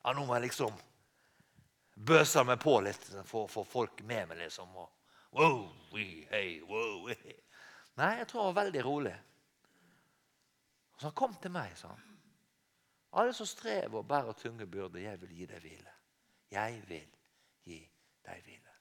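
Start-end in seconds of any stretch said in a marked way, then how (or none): 0.0-7.3 a hint of a pushy crowd-pleaser; lightly mock a show-off working the crowd; mock showy entrance. Preacher mocking a flashy Jesus greeting crowds, lightly hammed. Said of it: "ikke noe sånn her". 13.2-18.8 much softer and lower, gentle invitation; calm and quiet. Retold or imitated. Preacher voicing Jesus inviting the weary, hushed and calm. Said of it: "stille og rolig".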